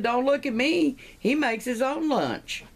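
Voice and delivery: high-pitched indignant denial; hammed-up high protest; defensive protest. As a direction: play a wife refusing all blame, voice way up. Third wife denies the blame.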